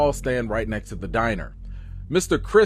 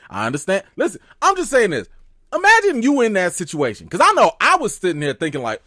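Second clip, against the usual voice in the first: ordinary talk, nothing marked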